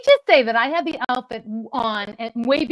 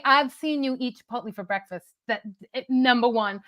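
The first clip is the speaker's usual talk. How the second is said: usual speaking voice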